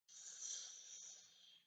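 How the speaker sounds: long unvoiced shh of the wind; breathy shh of wind through leaves; wind whisper. A narrator's reading. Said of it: "It whispers".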